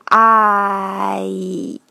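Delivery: long "I" sliding from ah to ee; slow sustained glide on "I"; diphthong glide. Shown as a demonstration. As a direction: hold "I", then spread the lips to close it. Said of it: "往回收的时候".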